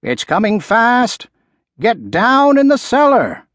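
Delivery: high, urgent farmer's call; distant shout. A narrator's reading. Farmer warning of the coming tornado, shouting in the distance. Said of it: "the farmer shouting in the distance".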